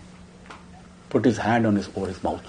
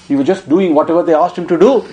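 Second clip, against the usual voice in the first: usual speaking voice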